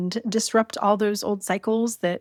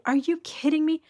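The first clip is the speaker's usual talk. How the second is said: higher-pitched indignant retelling, not shouted; high, disbelieving mock confrontation; mild retold scream; 7 semitones higher than usual. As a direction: re-enact screaming at her without raising the volume. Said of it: "like, screaming at her".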